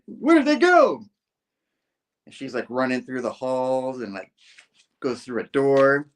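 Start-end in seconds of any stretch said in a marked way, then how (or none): 0.1-1.0 high, loud question; frantic mock shout. Spidey losing Janice on the stairs, high frantic shout. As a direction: call it out as the hero in pursuit. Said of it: "Spidey's like".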